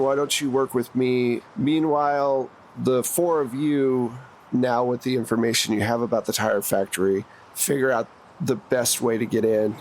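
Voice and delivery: ordinary talk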